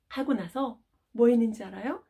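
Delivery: ordinary talk, nothing marked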